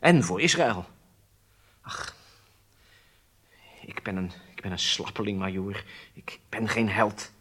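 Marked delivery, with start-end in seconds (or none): 1.8-7.3 soft, defeated admission of cowardice; ashamed confession. Acted. Prisoner confessing to the major, soft and defeated. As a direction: sigh it out, giving up.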